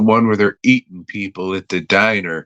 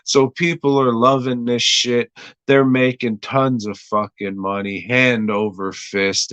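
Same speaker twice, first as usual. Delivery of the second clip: usual speaking voice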